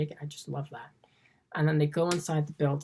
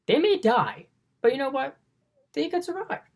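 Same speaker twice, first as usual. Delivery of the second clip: rising excitement; 10 semitones higher, 4 dB louder than usual. Real, not acted. Excited retelling of film climax.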